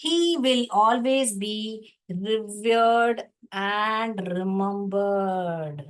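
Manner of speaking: very slow, word by word; very slow reading. Shown as a demonstration. Teacher reading the textbook line very slowly. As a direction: read it out slowly for the class. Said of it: "teacher reading text slowly".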